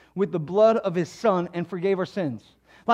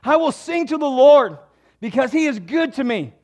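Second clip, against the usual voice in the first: trusting resolve; 6 semitones higher, 9 dB louder than usual. Acted. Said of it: "He said".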